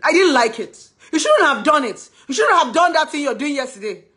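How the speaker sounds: high, loud scolding shout. Retold or imitated. Girlfriend losing composure with her man, shouting. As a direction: burst into a shouted complaint. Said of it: "I'll know that I'm shouting".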